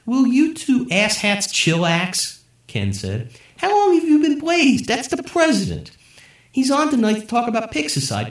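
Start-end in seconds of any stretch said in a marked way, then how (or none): 0.1-2.4 slow, high put-on stoner voice; slow stoner drawl. A narrator's reading. Ken tells his two friends to calm down, slow and high. 3.6-8.3 high, loud put-on stoner. A narrator's reading. Ken explains the president to his friends, high and loud.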